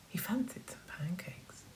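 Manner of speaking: hushed storyteller whisper; whispered aside to the children. A narrator's reading. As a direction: lean in and whisper it.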